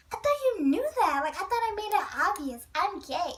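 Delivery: put-on high, bright-toned boy explaining himself; girly Nate voice. Nate telling her he thought she knew, girlish voice. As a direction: play him light and girlish. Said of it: "He sounded like a little girl".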